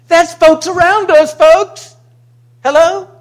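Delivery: high, loud call to the crowd. Real, not acted. Pastor rousing the congregation with a loud call. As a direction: shout it out to rouse them.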